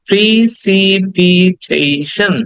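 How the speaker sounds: very slow "precipitation", pri-si-pi-tei-shan; syllable by syllable. Shown as a demonstration. Teacher breaking the word down for students, slow syllables. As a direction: stretch out each syllable of "precipitation".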